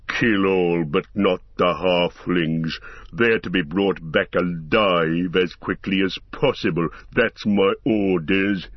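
low, slow growling orc; deep growl. A narrator's reading. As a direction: growl it deep like a brute captain. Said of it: "in a deep growl".

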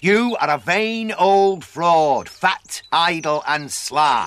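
slow, loud, angry accusation. Acted. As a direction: spit out each insult slowly. Apprentice finally tells master off.

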